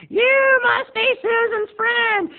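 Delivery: loud, slow, very high screech; hammed-up dazed old lady, shrieking her greeting; high-as-a-kite grandma. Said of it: "couldn't even make eye contact".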